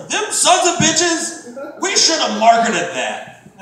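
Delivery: hammed-up grumbling executive. Comic mocking a Disney executive, slow mock outrage.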